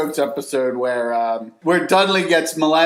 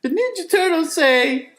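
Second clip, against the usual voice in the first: much higher, squeaky cartoon voice. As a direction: pitch way up like a cartoon turtle. Quoting cartoon anti-drug line.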